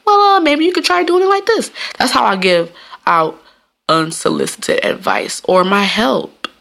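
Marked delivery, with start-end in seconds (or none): 0.1-1.7 gentle suggesting tone. Retold or imitated. Offering a coworker advice in a high gentle tone. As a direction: ease the advice in sweetly. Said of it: "I try to do it in that tone".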